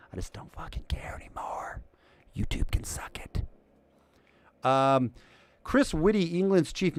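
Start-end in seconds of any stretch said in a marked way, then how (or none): 0.1-3.4 whisper. Real, not acted. Defiant aside about YouTube.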